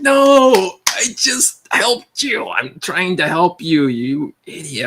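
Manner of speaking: high, slow exasperated protest. Retold or imitated. Frustrated employee pleads with his boss, slow and exasperated. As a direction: drag it out, fed up.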